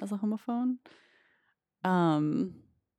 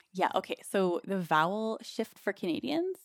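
usual speaking voice

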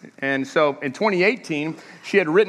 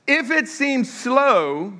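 drawn-out scripture line; 6 semitones higher, much slower than usual. Acted. Quoting scripture slowly.

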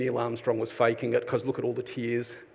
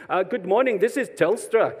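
put-on scam caller in a higher, louder American accent; American accent try; 7 semitones higher, 4 dB louder than usual. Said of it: "I was trying to do an American accent".

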